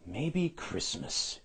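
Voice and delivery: thinking aloud. A narrator's reading. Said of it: "he thought".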